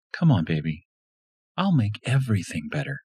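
a hint of a woman's voice, soothing; lightly voiced seductive wife; Lisa coaxing. A narrator's reading. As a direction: coax him tenderly. Lisa coaxes him up.

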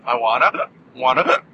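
interrupted by hiccups; words broken by hiccups. Real, not acted. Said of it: "excuse me, I have the hiccups".

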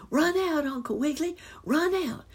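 high-pitched urgent call; urgent cry. A narrator's reading. Squirrel boy helping the rabbit escape, urgent cry. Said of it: "cried Johnny".

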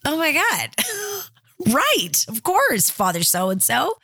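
high-pitched amazement with a little laugh; stunned realization. Retold or imitated. Retelling her reaction to the priest's advice, high amazed voice. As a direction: voice jumps way up as it finally clicks. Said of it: "And I was like".

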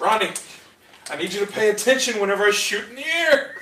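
calling a teammate. Acted. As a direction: demand attention across the battlefield. Calls out to teammate, acted.

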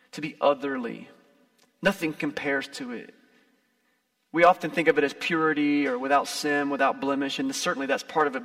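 ordinary talk, nothing marked

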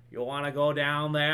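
put-on thick, hammy New England accent. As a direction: overblown New England boss. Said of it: "an overblown, like, New England accent".

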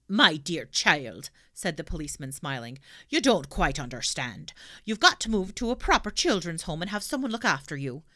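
condescending constable; london policeman. Acted. Policeman talks down.

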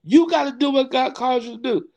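high-pitched, insistent mock admonition; imitated scolding pastor. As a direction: play a pastor firmly setting someone straight. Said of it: "just like he said".

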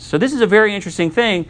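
normal talking voice

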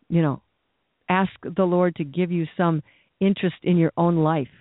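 ordinary talk, nothing marked

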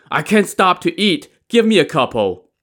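high-pitched hungry begging; pleading. A narrator's reading. Li Kui begs Daizong for some buns, pleading. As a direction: beg urgently for a bite.

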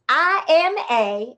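slow, loud, spelled out; call and response. Acted. Myrtle prompting the audience, slow call-out. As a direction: read the sign to the crowd. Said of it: "say it with me".

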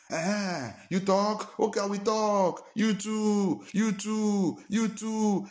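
high, heated tit-for-tat retort; mock quarrel. Preacher acting out a church quarrel, high and heated. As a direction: throw the words back like a petty squabble. Acted quarrel exchanging words.

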